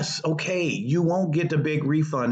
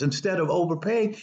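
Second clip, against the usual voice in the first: usual speaking voice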